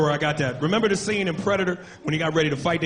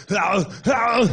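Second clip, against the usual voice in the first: grunt. Retold or imitated. Predator grunt at Arnold.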